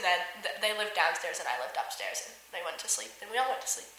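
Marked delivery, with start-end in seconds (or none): none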